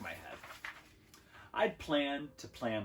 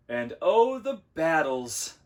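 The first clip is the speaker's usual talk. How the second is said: loud, high, drawn-out rapture; theatrically wistful; 6 semitones higher, 10 dB louder, slower than usual. A narrator's reading. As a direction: swoon grandly over imagined battles. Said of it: "stared off wistfully".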